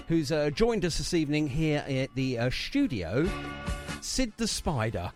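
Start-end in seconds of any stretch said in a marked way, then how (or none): none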